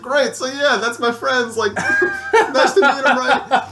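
high laughing through awkward words; embarrassed laughing. Real, not acted. Embarrassed intro of friends.

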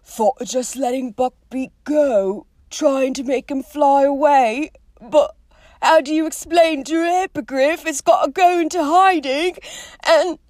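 anxious half-giant, not quiet; Hagrid voice. A narrator's reading. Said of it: "Then he said quietly".

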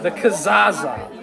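"Kazaza" slow with a hooting ring; a drawn-out hooted "Kazaza"; said with some hoot. Shown as a demonstration. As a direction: hoot out "Kazaza" again, slowly. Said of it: "you gotta say it with the, some hoot".

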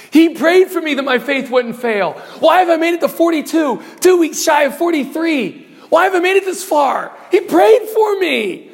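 impassioned preaching. Real, not acted. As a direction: preach it with rising fervour. Preacher's climax on being prayed for.

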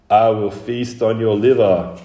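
loud monster menace, held back from shrieking; screamed threat. Acted. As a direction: roar it as a ghoulish threat. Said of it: "screamed the Indigo Man".

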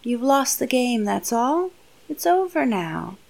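soft, hushed, falsely tender hush; quietly comforting his crying captive; soothing villain. Retold or imitated. The villain hushes the crying girl, soft and soothing. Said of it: "And he says".

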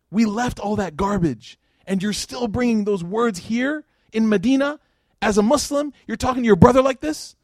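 retold stern rebuke climbing in pitch; building rebuke. Prophet rebuking Abu Dhar, retold.

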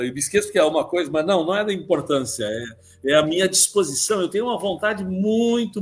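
ordinary delivery

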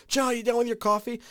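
high-pitched imitation of wife's repeated call; mock shout. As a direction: call up high through the house. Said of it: "once again has to shout".

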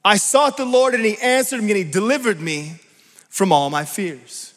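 loud, high preacher's declamation; impassioned psalm reading. Acted. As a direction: proclaim the psalm with rising fervour.

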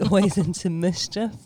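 normal talking voice

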